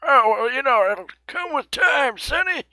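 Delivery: put-on high, creaky old-timer voice; old person voice. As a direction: play a grumpy old grandpa. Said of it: "really old person voice".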